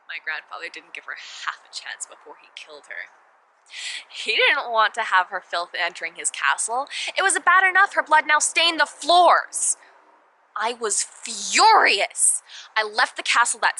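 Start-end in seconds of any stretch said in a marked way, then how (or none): 0.1-3.1 low, soft, near-whispered confession; hushed horror. Acted. Princess telling how her grandfather killed the girl, hushed. 4.1-12.4 rising fury. Acted. Princess raging at her grandfather's killing of the girl, furious.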